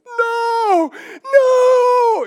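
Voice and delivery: put-on child wailing no. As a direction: wail like a little girl sent to her room. Small child dreading isolation punishment.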